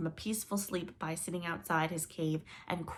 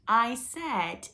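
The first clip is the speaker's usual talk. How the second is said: louder, distinct; 5 semitones higher, 8 dB louder than usual. Acted. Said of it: "more loudly and distinctly".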